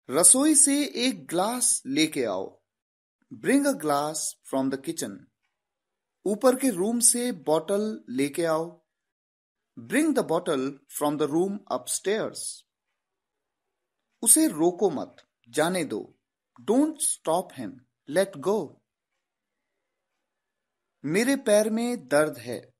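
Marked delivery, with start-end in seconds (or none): 3.4-5.1 slow, careful English for learners; slow teaching read; slow model sentence. Shown as a demonstration. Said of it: "teacher models English sentence". 9.8-12.4 very slow, word by word; slow dictation English; very slow model sentence. Shown as a demonstration. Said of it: "teacher models English sentence". 16.7-18.7 very slow, word by word; slow dictation English; very slow model sentence. Shown as a demonstration. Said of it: "teacher models English sentence".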